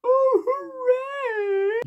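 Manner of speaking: squeaky falsetto crying wail, drawn out slow; mocking Samwise. Reviewer mocks the animated Sam as a blubbering idiot, high and wailing. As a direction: wail it like a silly weepy hobbit. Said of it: "Instead, he is".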